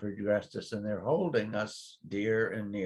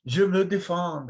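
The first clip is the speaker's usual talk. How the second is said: French reply; 9 semitones higher, 7 dB louder than usual. Retold or imitated. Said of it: "they say".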